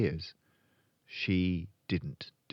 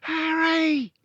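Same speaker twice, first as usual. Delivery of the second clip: loud, very high alarmed mother; high-pitched panicked call; frightened cry; 9 dB louder than usual. A narrator's reading. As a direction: cry out for your husband in fright.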